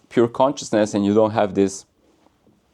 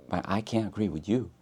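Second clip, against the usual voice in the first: imitating, hushed; 11 dB quieter than usual. Imagined opponent speaking.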